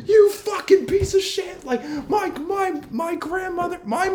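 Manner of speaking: high squeaky mock insult, slow; mock screaming stranger. Older man cursing at a teen driver, high mock yell. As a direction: squeak out a hammed-up tirade. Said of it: "he just kept screaming and yelling".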